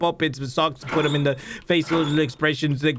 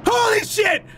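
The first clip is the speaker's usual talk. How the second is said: high-pitched startled exclamation; shrill-edged shock; shocked cry; 3 dB louder than usual. Real, not acted. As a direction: blurt it out in alarm. Just scared by monster.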